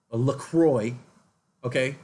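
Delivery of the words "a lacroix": LaCroix said "luh-KROY", with an English oy ending; anglicized LaCroix. Retold or imitated. Recalling the girl at the party, LaCroix as "luh-KROY". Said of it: "she said LaCroix several times".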